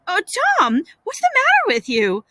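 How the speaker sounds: high, alarmed gasp. Acted. Aunt reaching the boy's bedside, gasping in alarm. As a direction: gasp it out in worry. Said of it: "She gasped".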